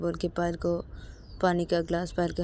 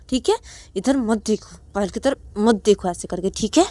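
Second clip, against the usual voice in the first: much louder, higher stern threat; angry mother; 8 semitones higher, 12 dB louder than usual. Acted. Mother to Ananya, furiously threatening. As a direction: warn her with a slap coming. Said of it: "Ananya की तरफ देखते बोलती कि".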